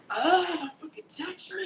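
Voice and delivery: high, strained mock whimper; mock pained plea. Retelling the tattoo session, high mock plea. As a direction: play the tattooed guy begging for mercy. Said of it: "I'm just like".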